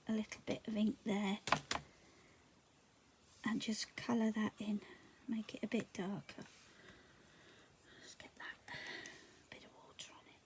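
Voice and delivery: soft whispered crafting; whispering. Real, not acted. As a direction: whisper it close to the mic. Whispers while colouring in.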